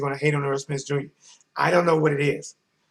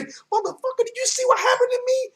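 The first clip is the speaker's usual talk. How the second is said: high squeaky complaint. Retold or imitated.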